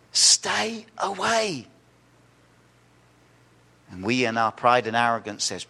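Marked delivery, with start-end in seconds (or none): 0.1-1.7 very slow, drawn-out plea; slow solemn warning. Real, not acted. Preacher warns congregation to stay away, very slowly. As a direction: stretch each word as a warning. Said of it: "solemn warning".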